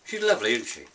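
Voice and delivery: normal talking voice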